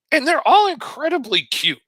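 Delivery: very high, squeaky gush; gushing aside. Real, not acted. Storyteller marvelling at one boy's luck, gushing aside. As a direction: pitch it way up in mock amazement.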